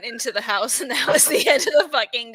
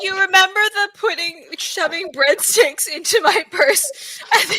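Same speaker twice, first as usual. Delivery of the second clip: words breaking up with laughter, louder; laughing; 7 semitones higher, 4 dB louder than usual. Real, not acted. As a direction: laugh through the memory. Laughing while recalling opera story.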